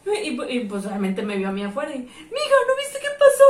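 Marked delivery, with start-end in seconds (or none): 2.0-3.4 high, loud, frantic quoted plea; distressed señora. Retold or imitated. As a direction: act out the woman's urgent question, high and loud. Quotes distressed woman questioning her.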